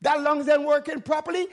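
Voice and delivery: ordinary delivery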